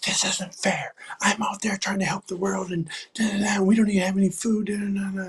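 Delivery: high-pitched, mock-indignant rant; worked-up protest, pitched well up; angry at God. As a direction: retell an angry complaint, rattling off the da-das. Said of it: "I got really angry at God".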